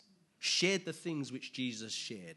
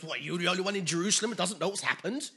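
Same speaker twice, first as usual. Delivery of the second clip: fast, louder disbelieving question; incredulous question; 4 semitones higher, 7 dB louder, faster than usual. Retold or imitated. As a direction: ask in disbelief. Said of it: "He goes".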